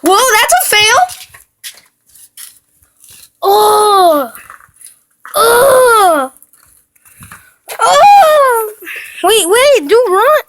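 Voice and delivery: high panicked yelling; panicking gamer. Real, not acted. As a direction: shout in startled panic. Chased in game, yelling.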